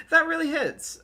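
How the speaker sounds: high, loud, slipping out through laughter; real laughing. Real, not acted. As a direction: laugh as you admit it. Streamer laughs at relatable line.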